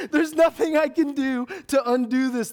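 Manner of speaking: loud, high-pitched comic despair; exasperated outburst. Acted. Preacher doomed to be known as loud, exasperated outburst. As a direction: cry out helplessly, hammed up.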